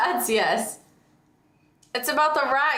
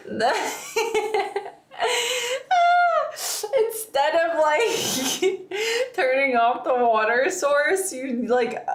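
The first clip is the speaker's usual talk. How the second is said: high giggling words; cracking up through the sentence; laughing while talking; 7 semitones higher than usual. Real, not acted. Friend laughing while retelling the kitchen flood, giggling hard.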